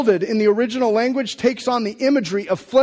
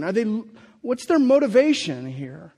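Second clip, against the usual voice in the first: ordinary talk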